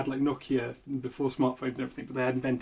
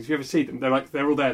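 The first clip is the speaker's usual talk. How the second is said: usual speaking voice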